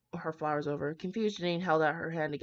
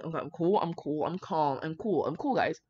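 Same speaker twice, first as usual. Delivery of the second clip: usual speaking voice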